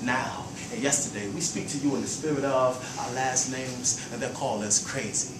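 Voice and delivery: measured, soft spoken-word delivery; poem recital. Acted. Poet reciting to the audience, in a hushed spoken-word voice. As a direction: let each line land like verse.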